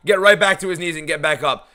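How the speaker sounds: ordinary talk, nothing marked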